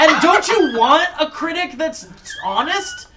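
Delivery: heated, high and loud; yelling. Real, not acted. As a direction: yell it indignantly through laughter. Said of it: "sorry about the yelling".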